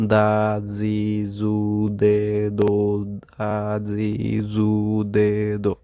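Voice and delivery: slow, separate Japanese syllables; teacher models each kana sound; syllable drill. Shown as a demonstration. Teacher drilling students on kana syllables, slow and distinct. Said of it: "জোরে জোরে practice করবেন".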